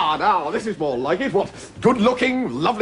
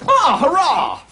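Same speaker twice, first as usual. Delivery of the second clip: gleeful cheer; 9 semitones higher, 4 dB louder than usual. Acted. Prince greets his guests gleefully.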